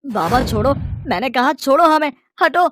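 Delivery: high, forceful shout; angry command. Acted. Son angrily ordering drunk father to let go. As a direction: order him off, rising to a shout.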